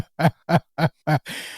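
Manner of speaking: real, rolling chuckle; amused laugh in short bursts. Real, not acted. Host cracks up at a listener's comment, laughing.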